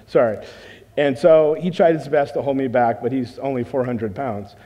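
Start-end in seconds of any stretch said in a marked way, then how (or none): none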